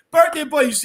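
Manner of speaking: loud, high birthday cheer; cheerful shout. Real, not acted. Host shouting a birthday cheer to a viewer.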